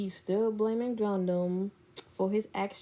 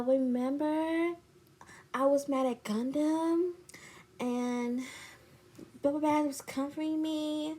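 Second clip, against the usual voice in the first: higher, softer mimicked friend; imitated confessing friend; 5 semitones higher, 5 dB quieter than usual. Friend confesses a kiss, mock hesitant. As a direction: stall through a mock confession.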